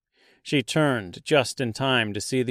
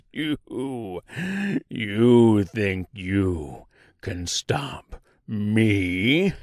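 very slow monster voice, rising at the end; taunting tree creature; 2 semitones lower, much slower than usual. Acted. Giant tree challenging the Tin Woodman, slow monstrous taunt. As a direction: drag it out into a mocking question.

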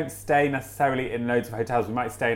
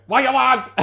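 high, loud, curt 'What you want?'; curt egg-hatch cook; 11 semitones higher, 5 dB louder than usual. Retold or imitated. Cook behind the egg hatch barking at a guest, high and loud. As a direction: snap the question through the hatch. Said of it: "this little voice going".

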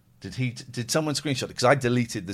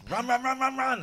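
much higher, urgent repeated "run"; urgent running cry; 13 semitones higher, 3 dB louder than usual. Retold or imitated. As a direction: act out a panicked dash through tunnels. Acting out fleeing through haunted tunnels.

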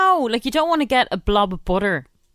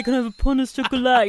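quick, higher pain au chocolat with French vowels; mock French accent; 4 semitones higher, faster than usual. Challenging co-host on pastry names, quick mock French accent. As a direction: rattle off a put-on French order. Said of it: "are you gonna say".

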